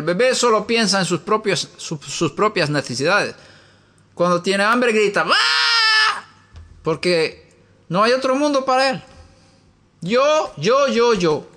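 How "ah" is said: put-on high wailing baby scream; baby's cry. Imitating a hungry baby crying out, high mock wail.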